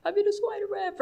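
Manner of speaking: high, thin cry without full volume; mock scream for help. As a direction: imitate a panicked kid calling for rescue. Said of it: "and I screamed. I was like".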